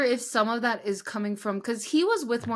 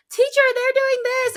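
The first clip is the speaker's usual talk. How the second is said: put-on much higher, louder whiny child voice; tattling kid; 8 dB louder than usual. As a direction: play the tattling student calling out to teacher. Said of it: "And they'd be like".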